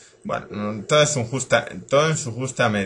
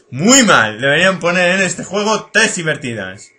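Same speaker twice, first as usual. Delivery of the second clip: loud, higher mock scolding; regañina exagerada; 4 semitones higher, 9 dB louder than usual. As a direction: scold the game at full voice. (regañina en voz alta, indignación exagerada)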